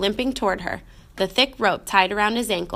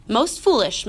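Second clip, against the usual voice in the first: ordinary delivery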